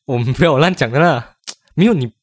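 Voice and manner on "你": ordinary delivery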